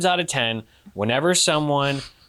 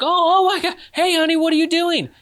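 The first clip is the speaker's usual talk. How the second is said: put-on much higher-pitched caught-out boyfriend; mock flustered surprise; 12 semitones higher, 3 dB louder, faster than usual. Boyfriend opens the door to surprise girlfriend, mock startled high voice.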